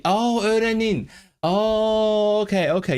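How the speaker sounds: high, drawn-out oh of realisation; sudden understanding. Real, not acted. Streamer finally gets the Azeri word, surprised. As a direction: let it dawn on you, pitch soaring.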